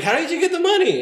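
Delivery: very high, loud, laughing squeal; retold astonished question. Dreamer asking friend about Porsche, astonished squeal. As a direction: squeak it out in mock amazement.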